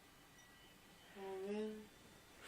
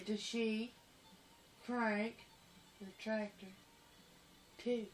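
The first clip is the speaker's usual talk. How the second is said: lower, softer words with long pauses; 3 semitones lower, 8 dB quieter than usual. Real, not acted. Repeating a question to a listener, word by word. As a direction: repeat it one piece at a time.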